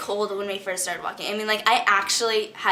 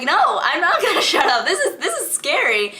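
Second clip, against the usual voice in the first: high, loud, laughing refusal; retold friend argument, louder and higher, laughing; laughing protest; 9 semitones higher, 6 dB louder than usual. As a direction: protest through giggles, half scared. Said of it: "And I was like".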